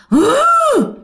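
put-on high, loud rasping gasp of an old woman; a ghostly old woman's hự. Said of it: "nghe cái giọng hự vậy đó". (tiếng hự cao và to của bà già ma)

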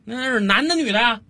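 high-pitched exasperated calling; impatient dad. Acted. Dad calls from the kitchen to his son, impatient. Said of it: "小李急了， 在厨房里头又冲着儿子喊了一句".

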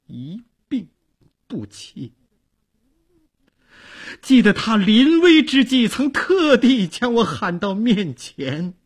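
grief-stricken, broken by long pauses; faint starts swelling into high, emotional bursts; halting, broken speech. A narrator's reading. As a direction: struggle to get it out through sorrow. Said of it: "她用不连贯的语气 断断续续地对寇准说".

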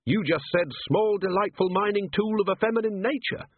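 Carrot's voice, lifted in amusement; amused young watchman. A narrator's reading. Carrot translating Angua's botched Dwarfish, amused.

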